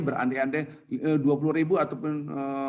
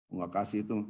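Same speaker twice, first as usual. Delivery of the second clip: low, softer begrudging mutter; grudging giver; 7 semitones lower, 5 dB quieter, slower than usual. Acted. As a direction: imitate someone handing money over unwillingly. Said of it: "Oh, ngomong itu".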